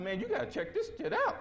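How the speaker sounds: very high, squealed retold excitement; excited urging. Calling Bobby about the website find, excited urging. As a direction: burst out high, urging a friend.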